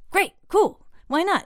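high, eager quoted reply; eager agreement. Retold or imitated. Band tells old manager yes to shows, eager agreement. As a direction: imitate a band readily agreeing.

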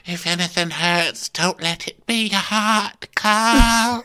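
high, drawn-out syrupy comforting; hammed-up high voice of tender drama; Natasha. Natasha seeing Kyle off to hospital, high mock-tender farewell. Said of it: "And Natasha's like".

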